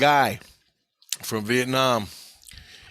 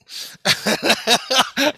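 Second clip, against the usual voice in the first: big loud burst of laughter, pitched up; loud high laugh; 10 semitones higher, 6 dB louder than usual. Real, not acted. Laughs at veteran's story.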